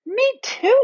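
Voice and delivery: put-on high, alarmed little-girl voice; frightened agreement, very high; distressed child. A narrator's reading. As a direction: cry it out, pitched far up. Lucy also feels the pulling.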